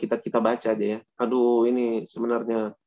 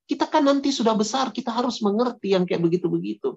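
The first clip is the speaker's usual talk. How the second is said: put-on Makassar accent; 6 semitones higher than usual. Preacher voicing a friend pushing kids, in Makassar accent. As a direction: coax in a Makassar accent, high and playful. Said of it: "ini pakai logat Makassar".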